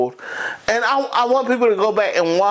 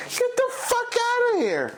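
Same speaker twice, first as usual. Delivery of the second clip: outraged disbelief. Acted. Ranting in outrage at Rogue.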